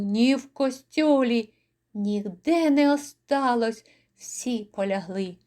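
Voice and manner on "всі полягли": ordinary delivery, nothing marked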